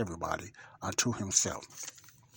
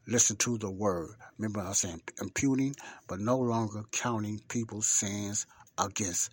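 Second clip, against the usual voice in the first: normal talking voice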